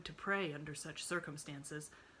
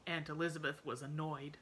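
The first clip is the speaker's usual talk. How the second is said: ordinary delivery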